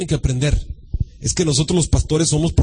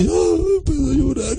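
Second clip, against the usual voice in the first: high hammed-up tearful wail; mock crying; 10 semitones higher, slower than usual. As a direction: imitate a preacher turning on tears. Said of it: "Aquí llorar".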